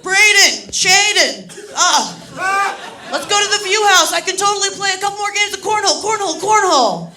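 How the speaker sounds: put-on loud, high drunken hollering; drunk bro. Drunk straggler calling to his friends, loud and high-pitched slurring bro. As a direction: holler like a wasted frat guy. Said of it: "He's clearly the most drunk".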